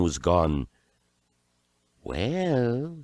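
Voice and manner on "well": high, rising, pondering wise woman. A narrator's reading. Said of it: "said she".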